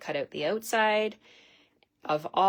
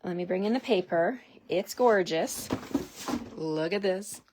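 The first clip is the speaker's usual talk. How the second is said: ordinary talk, nothing marked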